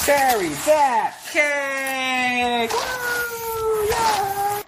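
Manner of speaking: high, whooping celebration; excited cheering. Real, not acted. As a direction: cheer it like a win. Cheering while burying cake.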